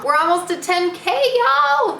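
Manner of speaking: high excited squeal. Real, not acted. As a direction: cheer it out to viewers. Excited subscriber milestone.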